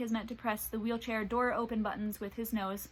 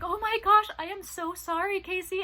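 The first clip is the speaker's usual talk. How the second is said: much higher, loud gasp then sorry; shocked apology; 9 semitones higher, 4 dB louder than usual. Retold or imitated. Owner finding melting gelato on her dog's leg, shocked apology. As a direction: burst out in shocked dismay to the dog. Discovering gelato stuck on dog.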